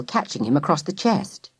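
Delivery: plain reading voice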